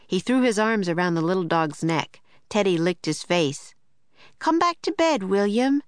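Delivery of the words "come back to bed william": called out high, from a distance; calling from another room. A narrator's reading. Sister calls William from inside the room, calling out. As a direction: call the boy back to bed. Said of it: "a voice called from inside".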